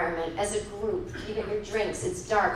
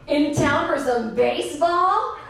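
slow, higher, rising question; oblivious clerk's hammed-up friendliness; chirpy small talk; 4 semitones higher, 3 dB louder, slower than usual. Rental guy to grieving customer, chirpy small talk.

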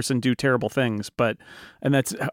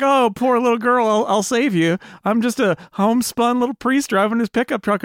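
mock Southern priest; 7 semitones higher than usual. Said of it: "that Southern accent".

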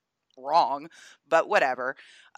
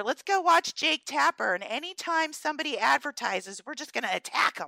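lightly acted higher-pitched activist; mock rallying cry; 7 semitones higher than usual. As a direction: imitate an eager mob making plans. Mimics outraged activists rallying online.

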